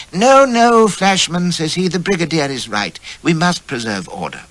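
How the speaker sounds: put-on high, loud, flustered old officer; a fretful elderly commander; fussy old general. A narrator's reading. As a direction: Elfie fussing at Flashman. Elfie voiced as old general.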